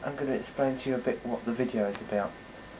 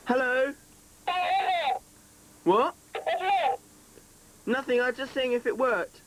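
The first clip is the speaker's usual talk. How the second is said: yelling across the room; 11 semitones higher than usual. Real, not acted. Dad calling into home intercom.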